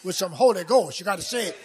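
full-force pulpit delivery; impassioned preaching. Real, not acted. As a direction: drive each phrase home to the congregation.

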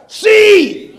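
shouted key word. Real, not acted. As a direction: fling the word out to the congregation.

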